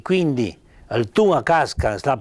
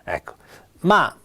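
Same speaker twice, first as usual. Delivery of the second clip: ordinary delivery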